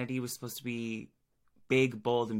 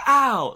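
sharp high yelp of fake pain; 14 semitones higher, 18 dB louder than usual. Pretend ow as the mustache comes off. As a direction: yelp as if the mustache ripped skin off. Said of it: "She was like".

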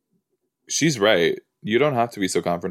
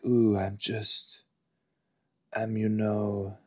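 soft, slow, broody mumbling vampire; secretive, brooding vampire; 7 dB quieter than usual. Acted. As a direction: play it secretive and hushed, trailing off. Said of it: "so secretive and".

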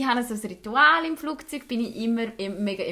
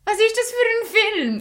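nosy stranger voice; 11 semitones higher, 6 dB louder than usual. Retold or imitated. Imitating seatmate asking questions.

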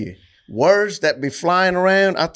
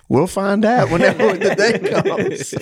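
higher voice breaking into laughter; laughing retort; 6 semitones higher than usual. Real, not acted. Man answering the coach who judged him, laughing retort. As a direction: laugh through the comeback.

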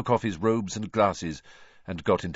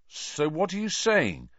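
tense; 11 semitones higher than usual. A narrator's reading. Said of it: "looking very tense".

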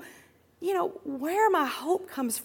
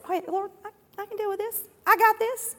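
self-assured believer; 7 semitones higher than usual. Acted. Self-sufficient believer assuring the Lord, high mock confidence. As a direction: start small and high, then firm up, lightly mocking.

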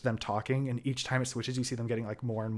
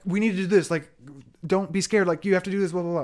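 higher, louder, fast pleading; frantic rambling; 7 semitones higher, 6 dB louder than usual. Retold or imitated. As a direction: mimic the mother babbling in panic to her family. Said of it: "Annie starts rambling".

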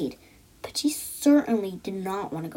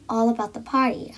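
plain reading voice

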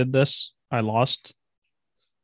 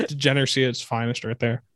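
normal talking voice

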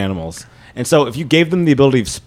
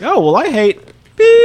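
higher and louder, slow mock voice; mock Mario voice; 10 semitones higher, 5 dB louder than usual. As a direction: ham up the friend's blurt. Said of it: "I'll be like".

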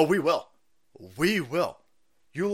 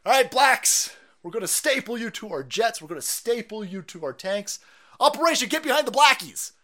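higher, louder barking commander; mock South Park general; 7 semitones higher, 6 dB louder than usual. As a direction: announce it like a cartoon general. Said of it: "And they're like".

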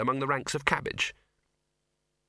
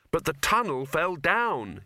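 slightly raised, higher insistence; said loudly; 6 semitones higher, slower than usual. A narrator's reading. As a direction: insist on it as if hoping it's true. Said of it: "saying it loudly in the hope".

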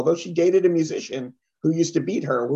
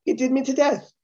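higher-pitched, quick Irish lilt; genuine Irish accent; 8 semitones higher than usual. Retold or imitated. Comic shows how Irish people say it, Irish accent. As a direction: toss it off high, like an Irish native. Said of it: "which an Irish person would go".

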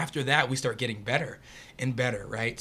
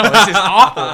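loud, higher verdict through laughter; laughing dismay; 8 semitones higher, 17 dB louder than usual. Retold or imitated. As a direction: laugh at how bad it was. Laughing retelling of a lost game.

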